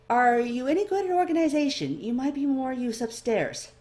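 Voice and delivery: bright curious clerk sizing up the newcomer; eager elf librarian. A narrator's reading.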